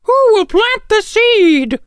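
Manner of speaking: a hen hollering for help with the seed; calling loudly. A narrator's reading. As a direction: call it out loud across the barnyard. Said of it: "she called loudly".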